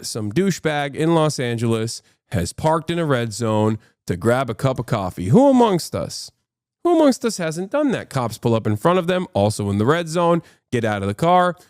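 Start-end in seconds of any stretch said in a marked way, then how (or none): 5.3-8.1 mock-innocent sarcasm. Real, not acted. Sarcastic rhetorical question.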